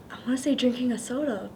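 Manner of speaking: ordinary talk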